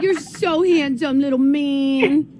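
high and very slow cooing praise; doting dog voice. Acted. Host praising her dog Maverick in slow high baby talk. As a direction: melt over the dog as you praise him. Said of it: "I don't even sound human".

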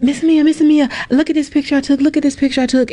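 put-on high, eager child voice; excited kids. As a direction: call out like an excited kid.

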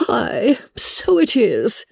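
high, gasping cry of realisation; gasp, then draw the words out high and airy. A narrator's reading. Mother suddenly recalling the ball's date, crying out. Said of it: "cried her mother".

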